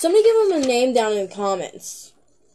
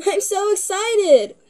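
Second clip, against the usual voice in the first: excited; 11 semitones higher than usual. Acted. Reading the excited letter aloud.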